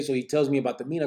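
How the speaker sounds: usual speaking voice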